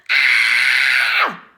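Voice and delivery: a sudden loud panicked scream; loud high scream. A narrator's reading. Girl spots a worm in cubby, screaming. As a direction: shriek in fright at the worm. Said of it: "running around the room screaming".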